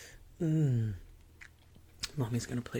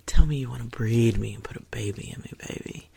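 breathy whispered taunt; seductive whisper; 8 dB louder than usual. Acted. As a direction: whisper it close and teasing. Whispers commands.

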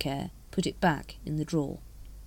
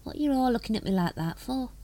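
higher-pitched sulky grumble in character; sullen; 7 semitones higher than usual. A narrator's reading. Nobby challenging those looking at him, sullen. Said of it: "and added sullenly".

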